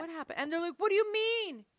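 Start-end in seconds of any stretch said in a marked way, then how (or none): 0.0-0.4 softer, higher feigned surprise; playing innocent. Mom playing innocent to kids. 0.8-1.7 high-pitched mock protest; indignant kids. Kids protesting to their mother.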